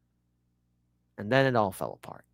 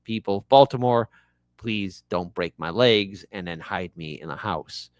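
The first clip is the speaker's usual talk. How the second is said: ordinary talk, nothing marked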